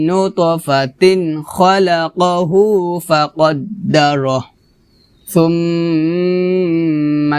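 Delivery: slow, measured tilawah with long drawn vowels; Quran recitation. Acted. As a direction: recite for students to copy. Teacher recites Surah Abasa. (ধীরে ধীরে তিলাওয়াত, শিক্ষার্থীদের অনুসরণের জন্য)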